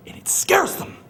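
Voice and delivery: taunting whisper. Acted. Taunting the office.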